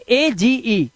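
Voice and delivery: AGE spelled out slowly, letter by letter; slow letter spelling. Shown as a demonstration. Teacher spelling AGE slowly for students. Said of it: "यहां पे जी के बाद ई है".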